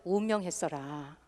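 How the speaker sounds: soft Jeolla dialect ending. Shown as a demonstration. Host demos the Jeolla way to announce a death, softly. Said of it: "전라도에서는".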